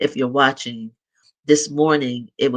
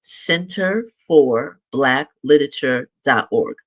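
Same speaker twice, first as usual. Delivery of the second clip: slow, measured dictation of a web address; slow and deliberate, spaced for note-takers; slowly, for writing down. Real, not acted. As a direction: spell it out word by word, unhurried. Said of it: "I'm gonna say it slowly".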